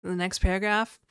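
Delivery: usual speaking voice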